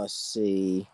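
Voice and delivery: normal talking voice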